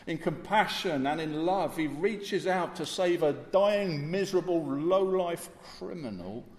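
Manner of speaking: loud, high, slowing list of his sufferings; fervent preaching. Acted. Preacher pressing the crucifixion on the congregation, fervent. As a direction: hammer each word of the passion home.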